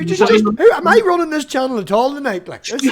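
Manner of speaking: high, loud indignant protest; hammed-up huffy protest; mock outrage. Mock outrage at being usurped.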